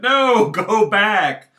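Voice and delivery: high, loud, slow shouted quote; hammed-up shout of "go away" from the tribe; mock German shout. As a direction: bellow it slowly like a furious tribe. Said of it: "the Germans were like".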